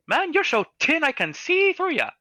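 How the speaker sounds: high, loud little gnome. Acted. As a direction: marvel like a tiny gnome. GM voices the gnome again.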